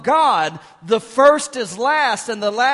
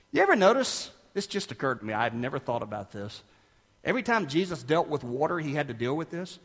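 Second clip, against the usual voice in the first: usual speaking voice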